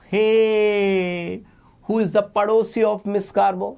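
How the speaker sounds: long high hey, then a quick question; playful calling out. Pretend. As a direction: call round the imaginary neighborhood. Calls out about imaginary neighbor.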